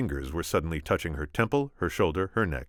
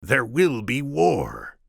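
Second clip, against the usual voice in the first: drawn-out high, grave proclamation; slow theatrical Sicilian decree; slow Sicilian pronouncement; 9 semitones higher, 7 dB louder, much slower than usual. Acted. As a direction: savour each word slowly.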